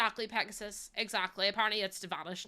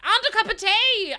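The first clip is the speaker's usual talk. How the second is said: indignant exclamation; 11 semitones higher, 12 dB louder than usual. Real, not acted. Outraged at granny's demand.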